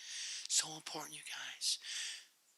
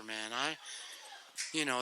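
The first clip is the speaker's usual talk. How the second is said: ordinary talk, nothing marked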